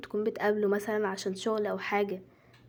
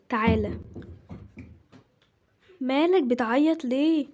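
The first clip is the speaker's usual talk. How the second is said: loud, raised voice of a worn-out mother; harried mother, exasperated; 4 semitones higher, 5 dB louder than usual. Acted. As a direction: call out over a crying child.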